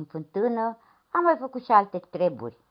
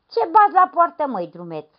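gruff priest; 10 semitones higher, 6 dB louder than usual. Acted. Priest questions the stranger.

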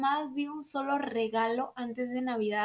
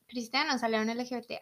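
ordinary delivery

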